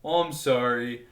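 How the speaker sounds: slow, bad Canadian accent; overly polite bad Canadian accent. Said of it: "It's a terrible Canadian accent".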